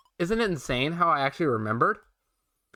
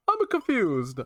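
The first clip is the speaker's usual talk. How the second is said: Luigi voice; 7 semitones higher, slower than usual. Acted. Voicing Luigi in dialogue.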